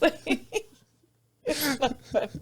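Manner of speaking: helpless laughter breaking up the words; laughing. Real, not acted. Co-host caught giggling at the legs story, laughing. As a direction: try to object through real giggles. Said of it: "What are you laughing about".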